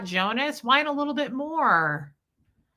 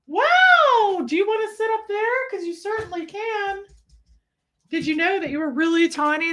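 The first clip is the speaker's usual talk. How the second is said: high pet voice; 9 semitones higher, 4 dB louder than usual. Real, not acted.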